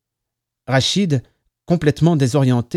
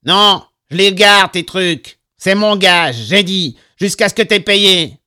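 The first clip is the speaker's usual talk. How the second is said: loud, raised stubborn refusal; heated, higher-pitched retort; arguing back; 6 semitones higher, 7 dB louder, slower than usual. A narrator's reading. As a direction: stand your ground loudly. Landlord arguing back in quarrel.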